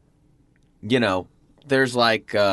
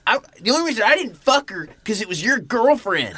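high, hammed-up threatening voice; a pitched-up mock menace; playful fake tough guy; mock tough threat; 8 semitones higher than usual. Mock threat, retold line.

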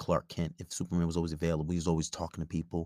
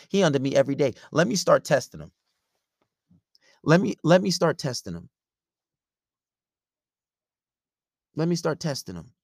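higher, louder mock-girlfriend voice; fed-up girlfriend; 8 semitones higher, 6 dB louder than usual. Girlfriend deciding to test her clingy man, fed up. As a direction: play her plotting to push his limits.

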